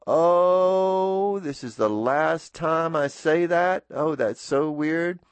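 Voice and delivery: wistful surprise. Real, not acted. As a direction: let the ending sink in as you say it. Realizes it's his last sign-off.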